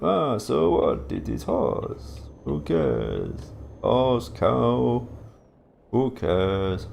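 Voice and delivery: caricatured Eastern European supplier, indifferent; mock Eastern European accent. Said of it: "that's my racist accent".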